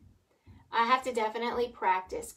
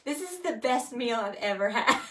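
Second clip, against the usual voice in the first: laughing; 4 dB louder than usual. Pretend. Joking over the meal, laughing.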